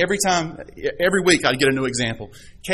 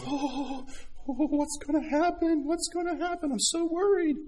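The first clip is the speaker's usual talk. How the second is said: high, breathy mock worry, much higher and softer; mock fretting; 12 semitones higher, 8 dB quieter than usual. Preacher mimics a worrier staring at the ceiling, high fretful whimper.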